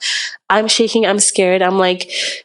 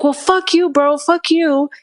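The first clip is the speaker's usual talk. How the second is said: retold angry outburst; 7 semitones higher than usual. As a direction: imitate a friend blowing up at someone. Retold outburst at Uber driver.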